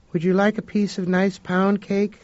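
eerily cheerful hostess; Leona's offer. A narrator's reading. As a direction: brightly offer cake to a guest. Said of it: "Leona said".

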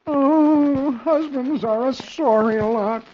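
high, slow, drawn-out moan. A narrator's reading. Dagwood chewing his nails over the cost, self-pitying moan. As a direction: lament it with long stretched vowels. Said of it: "and moans".

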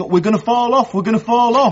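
high-pitched retold cry of alarm; mock scream. Accused brother defends himself to Henry, imitating her screaming. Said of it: "She was screaming".

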